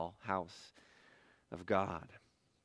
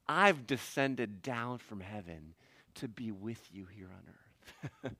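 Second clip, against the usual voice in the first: slow, soft and lowered; hushed divine reassurance; 6 semitones lower, 7 dB quieter, slower than usual. Acted. God come down to be beside Jacob, hushed reassurance. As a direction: bring it down gently, almost under the breath.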